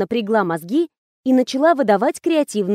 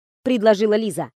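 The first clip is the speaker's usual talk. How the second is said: plain reading voice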